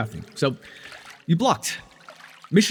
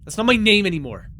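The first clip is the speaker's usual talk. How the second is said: higher, angry snap; sharp retort; 7 semitones higher than usual. Acted. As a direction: cut him off angrily. Rival uses her old name.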